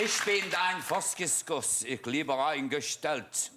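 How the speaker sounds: slow, careful broken German; slow German attempt. Shown as a demonstration. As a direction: spell out the only German you know. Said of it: "I can say".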